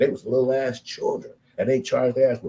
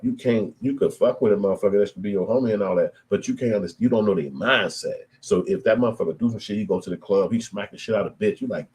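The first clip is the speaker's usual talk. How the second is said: ordinary delivery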